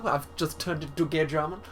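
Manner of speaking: theatrical, campy German professor voice; camp German. Acted. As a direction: ham up a flamboyant German boffin.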